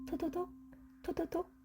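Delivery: tiny high, hushed pitter-patter; soft raindrop patter. A narrator's reading. Narrator lets the squirrel hear falling rain, delicate high patter. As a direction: tap out each drop lightly, high and soft.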